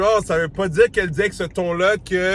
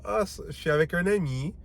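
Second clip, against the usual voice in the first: much softer, hinting "ami" means more; suggestive tone; 8 dB quieter than usual. Shown as a demonstration. Showing the viewer her hinting tone, soft and knowing. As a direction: let it slip out with a knowing hint. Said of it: "tu vois ce que je veux dire?".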